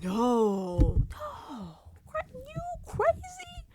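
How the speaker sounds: high, drawn-out mock denial; hammed-up placating voice; fake reassurance. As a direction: overdo insincere reassurance, pitch swooping up. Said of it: "Inside their head".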